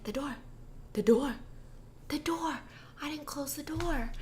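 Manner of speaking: soft, whispered realization; dawning realization. Retold or imitated. Guy realizing he left the door open, in a soft whisper. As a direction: murmur it as it dawns on you.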